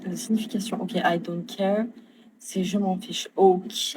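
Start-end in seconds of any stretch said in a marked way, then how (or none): 0.8-1.9 slow, soft English with a French accent, rising at the end. Real, not acted. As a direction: try the English phrase slowly. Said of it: "j'essaie d'améliorer mon accent en anglais".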